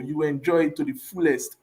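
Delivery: ordinary talk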